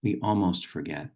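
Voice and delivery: "almost" leaned on, held a little longer; stress on almost. Shown as a demonstration. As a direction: press gently on "almost". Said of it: "the emphasis on almost".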